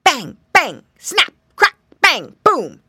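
loud, punchy gun-like noises; imitated bangs. A narrator's reading. Storyteller imitating chestnuts bursting, loud sudden bangs. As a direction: fire off each word like an explosion. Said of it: "there sounded this noise".

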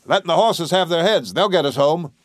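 bawled. A narrator's reading. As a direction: call it out over a storm. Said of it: "He leaned close to bawl".

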